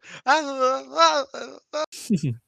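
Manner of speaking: high squealing mock yell, half laughing. Imitating Komomo yelling after the match, high mock yell. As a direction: let out a wordless shrieking yell. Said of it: "she just goes".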